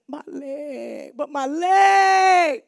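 loud, high mock-crying kid, slowing down; crying child. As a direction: wail it like a little boy. Child crying about his leg.

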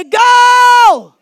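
huge high, drawn-out yell; explosive rallying cry; fired-up shout. Shown as a demonstration. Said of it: "act like you're fired up".

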